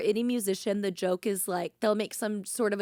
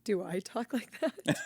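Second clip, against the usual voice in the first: "Do I talk like that?" dropped into a deeper, throatier put-on voice, slow and half-laughing; 5 dB quieter than usual. Answering the kids' impression in a mock deep voice. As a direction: sink it down low and ask it with a laugh. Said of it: "I like how her voice got deep".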